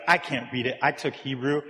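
ordinary talk, nothing marked

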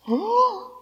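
high, sharp mock gasp of horror; mock gasp of alarm. The sermon crowd, told to discuss, gasps in mock horror. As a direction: gasp as if asked to talk to strangers.

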